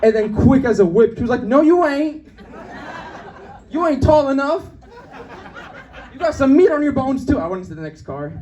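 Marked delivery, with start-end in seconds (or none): 1.4-7.4 high-pitched imitated jeer; mock heckling lady. Lady puts comedian down on train, mock jeering.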